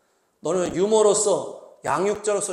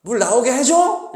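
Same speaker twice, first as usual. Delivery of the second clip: higher, louder, slow taunting question on 해줘; sarcastic angry question; 6 semitones higher, 9 dB louder, slower than usual. Retold or imitated. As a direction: throw the question back in scorn. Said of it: "이게 의문문의 이런 의미가 아닌데".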